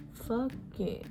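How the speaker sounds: ordinary talk, nothing marked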